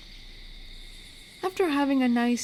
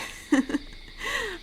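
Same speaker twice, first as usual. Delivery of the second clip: higher, light laugh to oneself; amused chuckle; 9 semitones higher, 3 dB quieter than usual. Acted. Wolf cryptid amused at being Moon's Point Wolf, amused chuckle. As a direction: chuckle it out briefly. Amused at own local fame.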